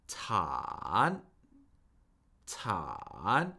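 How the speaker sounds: "Tan" dipping low, then rising high; third-tone "Tan" with a long low dip and upturn; falling-rising third tone. Shown as a demonstration. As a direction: draw "Tan" down, then swing it up. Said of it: "rises up higher than where it started".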